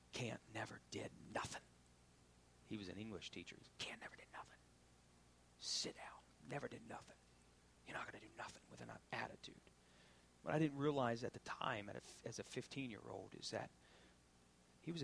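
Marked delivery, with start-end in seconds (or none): 0.1-9.5 a hint of a stern teacher, hushed. Preacher quoting his English teacher's rebuke, hushed. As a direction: quietly imitate the teacher's rebuke.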